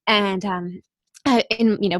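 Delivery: ordinary delivery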